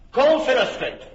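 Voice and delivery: a high, loud call across the hall. Acted. Duke calls loudly for his master of revels. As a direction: summon the servant with a ringing call.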